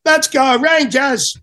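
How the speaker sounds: put-on long, high Brooklyn-accented cheer, drawn out slowly; imitating a Brooklyn attendant rallying the team; Brooklyn accent shout. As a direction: belt it like a Brooklyn locker-room guy. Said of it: "in his Brooklyn accent".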